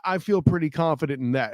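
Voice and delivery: ordinary delivery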